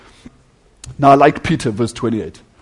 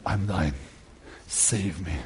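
hushed, much lower and softer prayer; night prayer; 12 semitones lower, 18 dB quieter, slower than usual. Acted. Luther praying alone at night, hushed and low. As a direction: pray it almost inaudibly.

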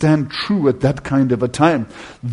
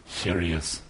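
hushed divine voice; 13 semitones lower, 17 dB quieter than usual. Retold or imitated. God presenting the beloved son.

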